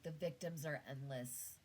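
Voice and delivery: ordinary talk, nothing marked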